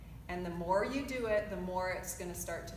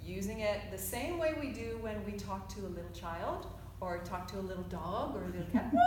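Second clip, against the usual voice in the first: usual speaking voice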